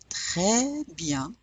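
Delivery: French "Très bien" as treh byan, no final consonants; throaty R in "Très bien", last N only nasal; silent S and N. Shown as a demonstration. Teacher repeats for learners, French "Très bien". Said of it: "We're not pronouncing the N either".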